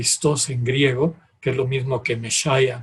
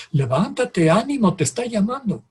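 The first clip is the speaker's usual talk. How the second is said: excited urging; 7 semitones higher than usual. Retold or imitated. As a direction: voice leaps up high, fast and eager. Crowd urges the blind man. (ánimo dicho rápido, agudo y entusiasta)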